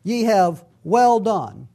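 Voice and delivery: "Ye have well done" slowed right down, "well" leaned on; slow, weighty quote. Shown as a demonstration. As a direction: savour each word of "Ye have well done". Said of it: "I kept looking at that phrase".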